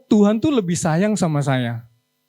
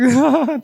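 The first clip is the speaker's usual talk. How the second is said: short laugh; 5 semitones higher, 4 dB louder than usual. Real, not acted. Laugh at the story.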